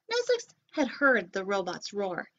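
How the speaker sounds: usual speaking voice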